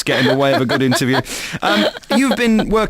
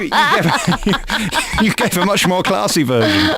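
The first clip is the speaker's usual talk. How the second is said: laughing; 2 semitones higher than usual. Real, not acted. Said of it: "laughing through words".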